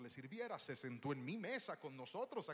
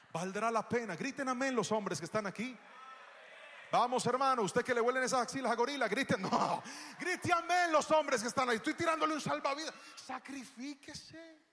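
preaching at full force; 10 semitones higher, 13 dB louder than usual. Acted. Preaches to the men.

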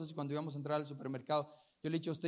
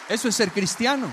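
loud, raised proclamation; emphatic preaching; 6 semitones higher, 14 dB louder than usual. Real, not acted. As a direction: drive the point home at full volume. Preacher drives home his point.

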